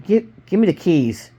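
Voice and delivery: agitated, stammered gunman's demand; angry. A narrator's reading. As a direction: demand it angrily, tripping on the first word. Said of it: "he asked angrily".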